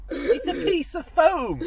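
high, loud, hammed-up shout of the line; loud catchphrase. To his co-host, a high hammed-up shout of the catchphrase.

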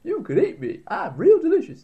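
squeaky eager ham; talking ham voice. Pretend. Voicing the living ham.

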